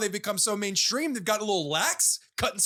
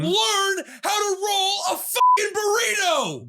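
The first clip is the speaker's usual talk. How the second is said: ranting; 7 semitones higher, slower than usual. Acted. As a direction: rant at the burrito makers.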